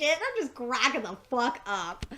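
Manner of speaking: usual speaking voice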